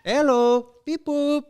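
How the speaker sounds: high chirpy game voice; Sims gibberish voice. Pretend. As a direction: chirp like a Sim. Imitating Sims speech.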